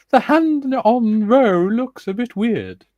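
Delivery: very high, loud, drawn-out squawk; exaggerated slow high-pitched mock voice; put-on high voice. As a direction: read it slowly in a silly high voice. Comment read in a put-on voice.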